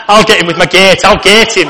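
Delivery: put-on loud, comic northern English accent; massive northerner. Preacher imagines a massive northerner at war, northern accent. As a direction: play a big northerner threatening battle. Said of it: "I could just see a massive northerner".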